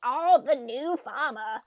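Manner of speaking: slow, hammed-up old mayor; drawn-out bad old-man voice; mock elderly mayor greeting; terrible Lewis voice. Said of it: "This is a terrible voice for Lewis".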